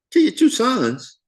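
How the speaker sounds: kill your two sons? thrown up high and slow; incredulous, voice jumping way up; shocked disbelief. Retold or imitated. Jacob repeating Reuben's offer back in high, slow disbelief.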